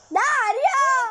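high, stretched-out cry of a name; urgent call. Real, not acted. Gamer calling Dario while in danger, urgent. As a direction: cry out for a teammate.

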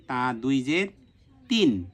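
normal talking voice

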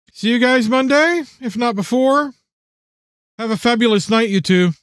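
high, lilting woman's voice; friendly farewell. A narrator's reading. Friend leaving father and daughter, breezy high farewell. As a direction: pitch it up for a breezy goodbye. Jennifer says goodbye at the door.

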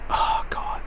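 soft, whispered groan; hushed exasperation; whispered despair. Real, not acted. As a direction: breathe it out in dismay. Despairing at his team.

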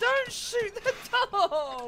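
slow, loud, high desperate plea; loud drawn-out plea. Real, not acted. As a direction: stretch it out, begging them to stop. Pleading again after dog shot.

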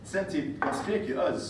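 ordinary talk

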